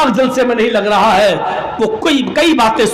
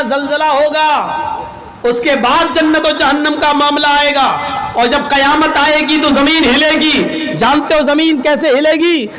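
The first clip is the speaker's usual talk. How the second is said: higher, raised preacher voice; lightly hammed-up pulpit preaching; mock preaching; 5 semitones higher than usual. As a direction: sermonise about doomsday to outwit them. Third maulvi preaches about doomsday.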